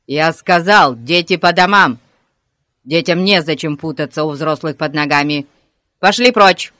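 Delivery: loud policeman's bellow; angry yell. A narrator's reading. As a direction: bawl at a boy to stop him. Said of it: "заорал он". (сердитый окрик)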